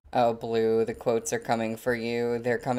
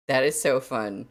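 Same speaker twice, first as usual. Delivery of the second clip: ordinary delivery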